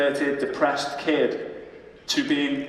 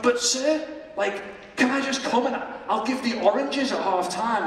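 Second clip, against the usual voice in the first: pleading; 7 semitones higher than usual. Retold or imitated. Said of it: "I went".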